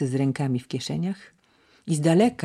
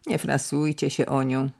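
plain reading voice

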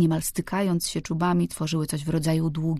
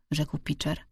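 usual speaking voice

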